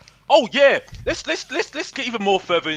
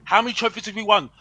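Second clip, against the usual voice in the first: ordinary talk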